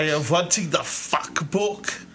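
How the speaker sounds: put-on thick German accent, slow. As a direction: imitate a German customer's broken English. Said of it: "with a very thick German accent".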